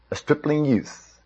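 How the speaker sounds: soft, hushed letdown; deflated. A narrator's reading. Narrator revealing the hoped-for champion to Saul, quietly deflated. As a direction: let it sink quietly, as an anticlimax. King sees the boy, let down.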